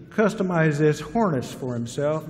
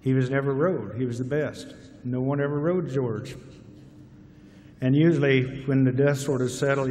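ordinary delivery

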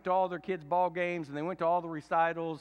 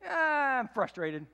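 loud, high exasperated groan falling into words; hammed-up frustrated outburst; 9 semitones higher, 5 dB louder, slower than usual. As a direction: let out a big acted sigh of frustration. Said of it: "I can't go no longer just say".

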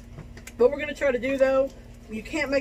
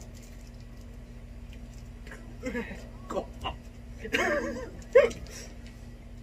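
wheezing silent laugh; high squeaky laughter, almost no voice; breathless high laugh; 13 semitones higher, 6 dB quieter than usual. Real, not acted. Reacting to sour candy.

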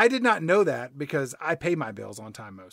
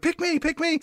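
high, eager little animal voice; excited animals; 12 semitones higher than usual. Retold or imitated. Animals to a shopper in the pet store, excited. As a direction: plead to be chosen, pitched way up. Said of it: "all the animals get excited".

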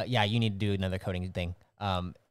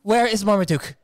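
loud, high-pitched demand; Alvora's outburst; 7 semitones higher, 10 dB louder, faster than usual. Acted. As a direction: shout in alarmed disbelief down the line. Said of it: "Alvora's like".

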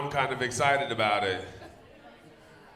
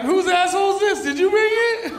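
loud, very high squealed questions; high-pitched mock disbelief; 7 dB louder than usual. Comic acting out a baffled lover's questions, high mock disbelief. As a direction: shriek it as a baffled, hammed-up reaction. Acts out the stunned recipient's reaction.

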